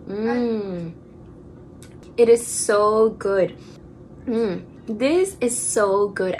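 speaking while savoring food; mouth partly full. Real, not acted. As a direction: between spoonfuls of ice cream. Seen 0: holds cup and spoon, tasting between words, mouth possibly full.

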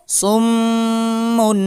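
সুম্মুন with the doubled মিম held long through the nose; nasal ghunna on mim. Shown as a demonstration. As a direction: chant সুম্মুন slowly, humming the mm. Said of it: "মিমের উপর তাসদিদ আছে, গুণনা করে পড়তে হবে".

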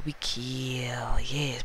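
whispered, hungry and scheming; sinister whisper. Acted. Banana monster whispering to teammates about the kill. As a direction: hiss it like a hungry monster plotting.

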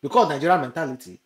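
ordinary delivery

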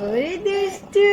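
high, slow teasing; playful tease. Real, not acted. Mother teasing her baby daughter, high and drawn out.

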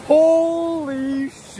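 high stretched yelp; cold shock. Real, not acted. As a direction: cut off by the cold.

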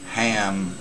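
ordinary talk, nothing marked

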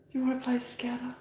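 fast excited whisper. Real, not acted. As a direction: whisper it to tease the dog. Whispered tease to the dog.

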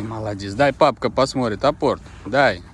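normal talking voice

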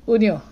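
"Unio" said OON-yo, opening on oo; "Unio" as OON-yo, with falling tune; comfortable pronunciation. Shown as a demonstration. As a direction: say "Unio" with no y at the start. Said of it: "I'll just pronounce how I feel comfortable".

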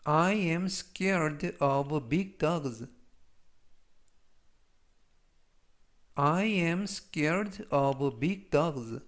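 slow, carefully spaced English model sentence; language-lesson model reading. Shown as a demonstration. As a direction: read it slowly for learners to repeat. Model sentences read for learners.

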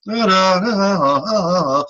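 slow, sung-like da-da-da; vocal demo syllables. Shown as a demonstration. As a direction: sing out each da evenly. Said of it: "Vowels are pure".

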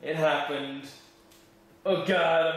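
normal talking voice

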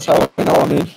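glitchy robotic mic audio; warbling digital call distortion; robotic voice glitch. Real, not acted. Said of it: "your voice went all robotic there".

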